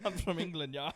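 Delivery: put-on teasing copy of an English accent; mock English accent. Friend echoing his mate's attempt, mock English accent.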